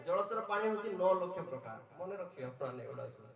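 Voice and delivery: ordinary talk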